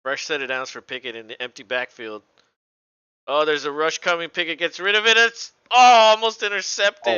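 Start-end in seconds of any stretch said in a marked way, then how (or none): 3.3-7.2 rising to a loud, high cry; excited play-by-play. Real, not acted. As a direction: build with the play and burst out on the near miss. Calling a near interception live.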